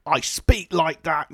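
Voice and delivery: slow, stern Northern English accent; imitating a Northern English commentator; Northern accent demo. Shown as a demonstration. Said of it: "He has a very kind of like".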